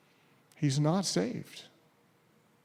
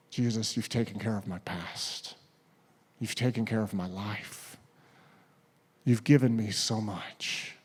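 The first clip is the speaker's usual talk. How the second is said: quiet grateful prayer; 5 semitones lower, 5 dB quieter than usual. Acted.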